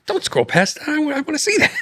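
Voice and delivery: rising high and eager; husband stopping wife's scrolling, eager; eager plea. Retold or imitated. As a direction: beg her not to scroll away. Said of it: "I'm like".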